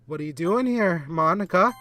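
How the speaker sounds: high, incredulous questioning; alarmed question. Real, not acted. Repeats question, more alarmed.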